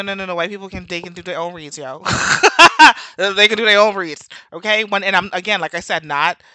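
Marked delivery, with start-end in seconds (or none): 2.1-3.1 loud, high laugh; real laugh bursting out; burst of laughter. Real, not acted. Amused at own remark about reads, loud laughter. 3.2-4.3 loud repeat just after laughing; amused insistence. Real, not acted. Insisting on New Yorkers' reads, loud and amused.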